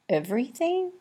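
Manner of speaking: high squeaky disbelief; squeaked. A narrator's reading. Olive stunned by the graveyard news, high squeak. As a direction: squeak it out in shock. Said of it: "squeaked Olive".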